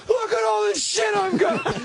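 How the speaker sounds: put-on high, excited drunk squeal; drunk girlfriend. Girlfriend showing off her loot, shrill excited drunk voice. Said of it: "And she's like".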